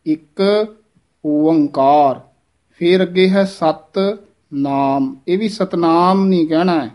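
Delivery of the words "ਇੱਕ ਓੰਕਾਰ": ਇੱਕ then ਓੰਕਾਰ as two separate, clear words; correct pronunciation. Shown as a demonstration. Teacher demonstrates the pure way for students, careful split. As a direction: say ਇੱਕ, pause, then ਓੰਕਾਰ with open ਊੜਾ. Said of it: "ਇਸ ਤਰ੍ਹਾਂ ਸ਼ੁੱਧ ਉਚਾਰਨ ਦੱਸਿਆ ਜਾਂਦਾ ਹੈ".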